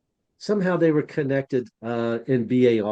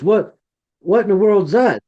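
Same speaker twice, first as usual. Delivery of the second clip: higher, louder mock-astonished question; retold astonishment; 8 semitones higher, 7 dB louder than usual. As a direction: imitate his amazed reaction. Retold astonishment at assembled shards.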